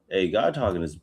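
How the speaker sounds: ordinary talk, nothing marked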